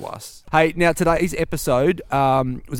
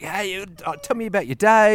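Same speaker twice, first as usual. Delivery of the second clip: eager, high, puppy-like hello; excited greeting; 7 semitones higher than usual. Retold or imitated. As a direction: greet her like an overeager dog. Imitating himself greeting wife.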